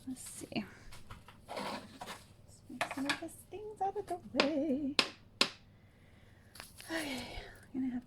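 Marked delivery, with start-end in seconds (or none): 0.1-4.1 soft unvoiced whisper; just breathe it out. Real, not acted. Whispered aside while crafting.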